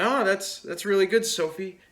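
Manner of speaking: high, loud, overdone encouragement; placating praise. Retold or imitated. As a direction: humor an annoyed little sister. Placating his annoyed sister.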